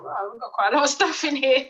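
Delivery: thrilled unpacking the parcel; recalling the excitement of the parcel; excited. Retold or imitated. Excited opening heavy package.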